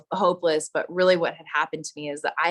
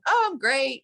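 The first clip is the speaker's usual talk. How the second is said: higher, forced bright oh; fake cheer; 7 semitones higher than usual. Scared woman lying to the old friend on the phone, forced cheer. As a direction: cover fear with a chirpy lie. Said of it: "And I'm lying".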